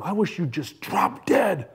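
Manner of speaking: retold bitter outburst at parents; angry son. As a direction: imitate a young man lashing out. Young man lashes out at parents.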